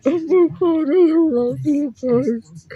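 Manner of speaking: high, loud, slow, overwhelmed tearful cry; in tears. Shown as a demonstration. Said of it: "this is how exactly how I was saying it in tears".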